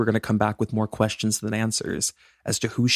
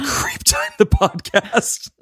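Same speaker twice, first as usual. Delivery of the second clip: high, slow, laughing delivery; 10 semitones higher, much slower than usual. Real, not acted. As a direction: push the name out through rising laughter. Cracking up at own slip.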